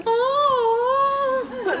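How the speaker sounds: high crying "Oh"; mock wail. A speaker imitating someone hiding a weak voice, high wail. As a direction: wail like a bad singer in church.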